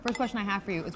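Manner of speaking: normal talking voice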